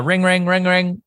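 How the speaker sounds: high sing-song ring imitation; mock phone ringing. Caller starting a phone role play, high mock ringing. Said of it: "Okay, I'ma call in".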